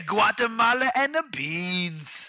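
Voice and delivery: high-pitched muddled fake Haitian accent. Host answering what the accent is, botched Haitian accent. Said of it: "What exactly is a Haitian accent?".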